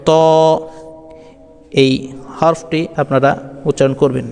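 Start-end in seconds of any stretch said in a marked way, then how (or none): 0.1-0.1 ত said alone with held vowel; tongue tip to upper tooth roots for ত; demonstrated letter sound. Shown as a demonstration. Teacher telling learners to say it like this, careful ত. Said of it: "এমনটিভাবে".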